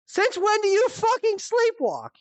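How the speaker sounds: high incredulous retold question. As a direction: pitch it up in disbelief. Retold disbelief at mom.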